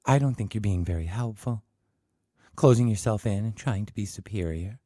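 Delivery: coldly. Acted. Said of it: "Susan said coldly".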